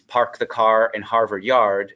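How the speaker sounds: General American accent with every R pronounced; classic American R's. Shown as a demonstration. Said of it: "with the cl-, classic American R's".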